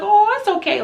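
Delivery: high, gushing forgiveness; acted reassurance. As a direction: wave the apology away in a high coo. Reassures the apologising client.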